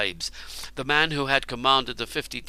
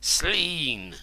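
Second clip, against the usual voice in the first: cursing; 5 semitones higher than usual. A narrator's reading. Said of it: "cursed Cernus".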